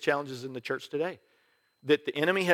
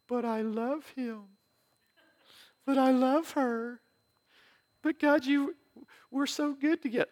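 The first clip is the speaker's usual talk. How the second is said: whiny pleading; 11 semitones higher than usual. Pretend. Mock believer pleading with God.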